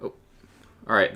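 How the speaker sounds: ordinary delivery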